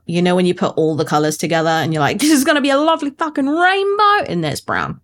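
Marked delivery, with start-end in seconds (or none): 2.2-4.2 excited. Retold or imitated. Imagined delight before a letdown.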